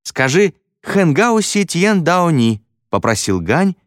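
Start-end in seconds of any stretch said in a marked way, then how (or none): 0.0-2.6 high, slow call; high drawn-out request; calling out a dare. A narrator's reading. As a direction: pitch it high and stretch out the foreign words. Pupil calls out a dare.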